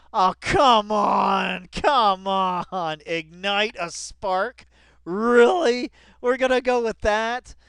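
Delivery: loud, high, disbelieving outburst; exasperated shout. Real, not acted. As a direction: yell it at the page, fed up. Exasperated at the quoted phrase.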